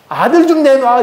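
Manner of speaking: high, hammed-up demand; quarrelling demand. Rachel demands a son from her husband, high demanding. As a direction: insist in a high acted wail. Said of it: "대판 싸우고 있어요".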